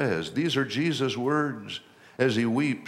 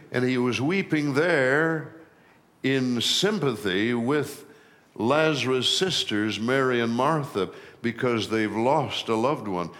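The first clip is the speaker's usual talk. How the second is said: ordinary talk, nothing marked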